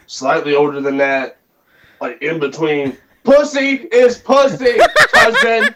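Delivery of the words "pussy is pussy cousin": laughing boast. Real, not acted.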